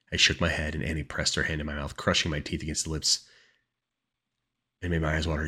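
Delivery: plain reading voice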